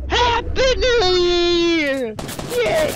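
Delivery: high, loud, long-drawn cheer; celebratory shout. Pretend. Player ending a New Year countdown, celebratory shout. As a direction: let the cheer ring out after the countdown.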